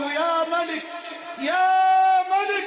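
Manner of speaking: loud, slow drawn-out call of يا ملك; crying out to the King. Retold or imitated. Old man in the Kaaba calling on God, loud and drawn out. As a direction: cry out يا ملك, stretching it in desperate prayer. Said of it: "فظللت أصرخ وأقول".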